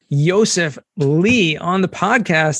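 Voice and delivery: high, ringing show-opener welcome; podcast host intro. Acted. Host welcoming listeners and introducing his guest, announcer style.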